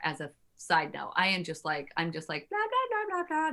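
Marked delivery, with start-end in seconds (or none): 2.5-3.5 mock singsong blah. Mocking her usual chatter.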